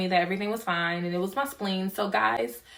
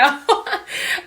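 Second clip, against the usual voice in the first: giggle; 11 semitones higher, 5 dB louder than usual. Real, not acted. Laugh at own craziness.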